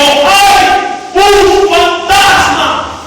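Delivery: high, drawn-out mock scream; frightened cry. Disciple in the boat seeing Jesus, high frightened cry. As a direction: cry out like a terrified fisherman.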